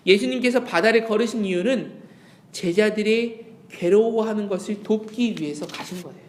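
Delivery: ordinary talk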